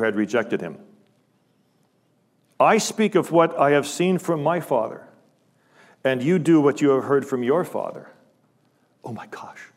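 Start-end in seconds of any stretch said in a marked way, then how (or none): 2.6-8.1 loud, high, ringing scripture quote; fervent preacher voicing Jesus; preaching force. Preaching Jesus' words at full force. 9.0-9.7 breathless hushed aside; real whispered amazement; awed whisper. Real, not acted. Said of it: "awed aside after the verse".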